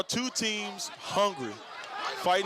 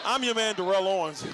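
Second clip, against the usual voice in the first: usual speaking voice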